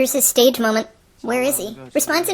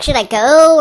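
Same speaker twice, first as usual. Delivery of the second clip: mock dilemma; 9 dB louder, slower than usual. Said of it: "Where you're like".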